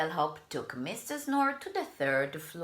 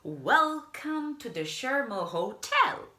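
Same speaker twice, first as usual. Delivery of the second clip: higher, louder eager bellhop welcome; cheerful bellhop; 8 semitones higher, 4 dB louder than usual. Acted. Bellhop welcoming Mister Snore, bright and eager. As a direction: greet the guest brightly.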